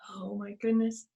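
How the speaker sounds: ordinary delivery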